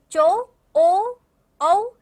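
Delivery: चो, ओ, औ each held long and loud; teacher stretching the vowels in चो, ओ, औ; isolated vowel sounds. Shown as a demonstration. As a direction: slowly sound out चो, ओ, औ one at a time. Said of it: "ऐसे इस तरीके से आ रहा है".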